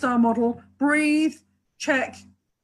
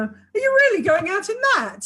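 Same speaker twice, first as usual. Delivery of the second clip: high, scornful, incredulous question; pitched high, sarcastic, can't believe it; disapproving inflection; 9 semitones higher, 4 dB louder than usual. Shown as a demonstration. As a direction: make it sound like a put-down. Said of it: "a whole different communication just by the inflection".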